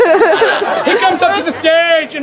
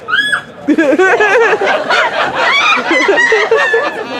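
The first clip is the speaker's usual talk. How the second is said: real laughter; 6 semitones higher than usual. Real, not acted. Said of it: "the squeak".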